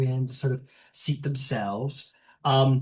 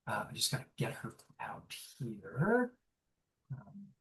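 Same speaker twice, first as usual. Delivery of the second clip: real whispered aside to himself; 4 semitones lower, 13 dB quieter than usual. Real, not acted. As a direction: mutter it off-mic, just breath. GM aside while finding picture.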